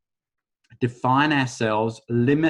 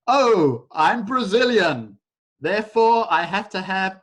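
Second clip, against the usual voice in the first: proud patriot voice; 7 semitones higher, 4 dB louder than usual. Retold or imitated. Acting out a nationalist.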